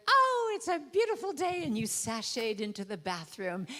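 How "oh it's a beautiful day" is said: much higher and louder, singing the words out; bright sing-song hello to the morning; gushing morning greeting. Retold or imitated. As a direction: greet the day like it's a gift. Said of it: "greet the day with".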